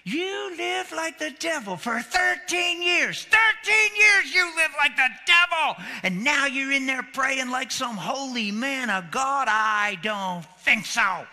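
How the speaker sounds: rising to a high, loud furious yell at the husband; quoted wife shouting scorn at her husband; angry wife shouting. Retold or imitated. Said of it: "When she actually used the word sick".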